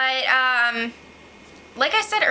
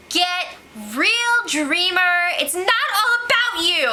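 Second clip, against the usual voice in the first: higher, punchy put-down; sassy scolding; 6 semitones higher than usual. Real, not acted. Vlogger mocking Frankie, sassy higher put-down. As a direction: tell him off with attitude.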